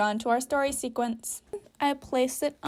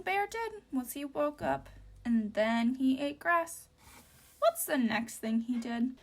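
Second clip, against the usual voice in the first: quiet; 8 dB quieter than usual. Real, not acted. Soft aside while gluing.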